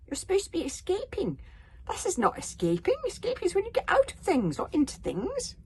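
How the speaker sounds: high, fretful dragon voice scolding Hiccup; anxious little dragon. Acted. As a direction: flap about in alarm, voice up high.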